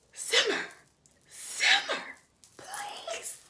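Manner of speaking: very high, strained pleading falsetto; pleading squeak. Retold or imitated. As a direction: beg your nerves to calm down in a tiny squeak. Calming her own anxiety.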